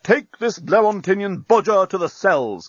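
high, forceful order; command. A narrator's reading. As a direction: order the guards sharply. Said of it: "commanded Bolfas".

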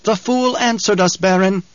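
high, lilting tenor; high-pitched sly adviser; gleeful henchman. A narrator's reading. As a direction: report it with mocking delight. Piter gleefully reports to Baron.

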